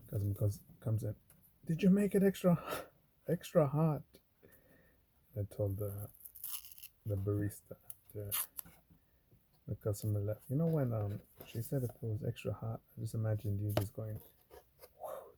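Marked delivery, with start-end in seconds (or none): 1.7-4.0 much higher, louder pushy question; demanding customer. Retold or imitated. Cousin asking the barista about the drink, high and demanding. 15.0-15.3 blowing sound. Retold or imitated. Imagined blowing on drink.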